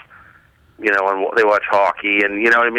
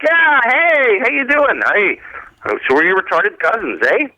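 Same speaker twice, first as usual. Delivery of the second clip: mock Canadian; 11 semitones higher than usual. Mock Canadian voiced in bit.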